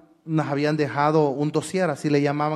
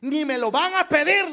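acted bluff, pitched high; bravata confiada; 10 semitones higher than usual. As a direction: brush off the worry in a high, cocky voice. Retold bluff to worried cousin. (fanfarronada en tono alto)

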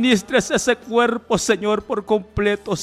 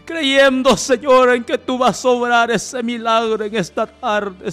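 loud, raised, fervent declaration; crying out in prayer; 3 semitones higher, 7 dB louder than usual. Real, not acted. Believing God for a miracle, loud fervent appeal.

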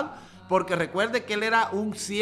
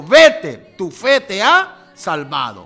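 proclaiming; 13 dB louder, much slower than usual. Acted. Jesus declares healing.

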